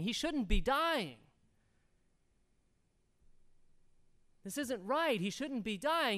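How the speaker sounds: high, loud, indignant protest; angry confrontation. Retold or imitated. Angry visitor confronting the dying man's wife, loud and high. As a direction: confront her, outraged at the injustice. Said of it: "he becomes angry about this".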